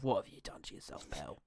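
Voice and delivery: hushed, half-whispered paternal scolding; mock-tender, very soft rebuke; fatherly reproach. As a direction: murmur it softly like a let-down dad. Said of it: "very much a fatherly relationship".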